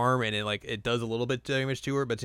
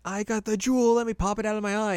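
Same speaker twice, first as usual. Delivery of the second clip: high whiny Tsubaki; 11 semitones higher than usual. Retold or imitated. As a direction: whine like a sore loser witch. Voicing defeated Tsubaki.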